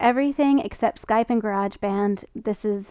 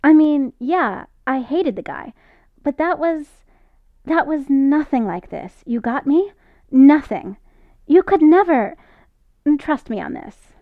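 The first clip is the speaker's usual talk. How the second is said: sudden harsh outburst, louder and higher; 4 semitones higher, 7 dB louder than usual. Acted. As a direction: snap out the words harshly. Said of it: "voice suddenly harsh".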